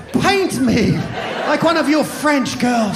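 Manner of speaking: high hammed-up Titanic quote; mock seductive line. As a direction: play it like a coy pin-up, over the top. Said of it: "I'm like".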